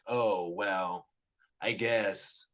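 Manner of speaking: ordinary delivery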